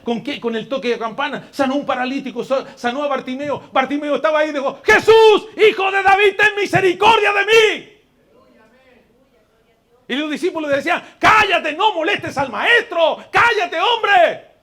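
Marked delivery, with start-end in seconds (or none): 4.8-7.9 high, loud shout for mercy; desperate cry. Acted. Blind beggar calls to Jesus. 11.2-14.5 high, loud rebuke, the last cállate drawn out slow; scolding hush. Acted. Disciples silence the beggar.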